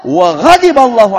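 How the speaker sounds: loud, slow, furious retort; angry wife snapping back at the mockers; angry Aisyah. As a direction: hurl the curse back in anger. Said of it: "Aisyah ... marah dan beliau mengatakan".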